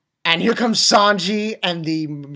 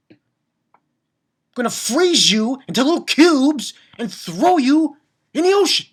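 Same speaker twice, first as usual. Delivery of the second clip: higher, louder, raging at the villain; furious rant; 8 semitones higher, 6 dB louder than usual. Real, not acted. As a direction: spit your fury at the villain. Ranting at the villain.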